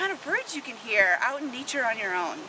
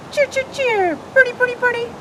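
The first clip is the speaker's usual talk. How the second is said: very high cheery birdsong; high cardinal call imitation; cardinal song; 11 semitones higher, 4 dB louder, faster than usual. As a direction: sing it like a bright cardinal. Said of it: "Cardinal wears a pointy hat".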